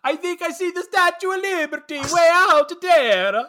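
loud, very high, hammed-up movie accent; Fabrizio's mock accent. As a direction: shout it high in an overdone foreign accent. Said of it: "Fabrizio ruining it with his accent".

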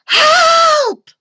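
very high, loud scream for help; drawn-out piercing cry; shout. A narrator's reading. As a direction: scream for rescue. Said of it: "shouted the children".

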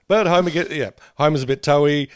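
usual speaking voice

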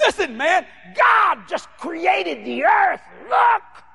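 high, slowing, emphatic shout; fired-up retold exclamation; excited proclamation. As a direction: announce astonishing news, stretching it out high. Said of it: "I got excited".

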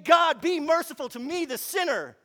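loud, high cry for mercy; agonized plea. Acted. Tax collector begging God for mercy, agonized. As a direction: plead loudly out of anguish. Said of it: "Do you see the agony?".

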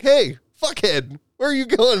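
loud, high shout to stop someone; mock calling out. Buddy hollering at the guy heading to the bar, mock calling out. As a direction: imitate hollering after a man walking off. Retelling Brad calling out to the driver.

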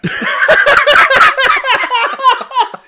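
big high helpless laugh; loud laughing burst. Real, not acted. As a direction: crack up loudly at the plan. Bursts out laughing at the Bible verse.

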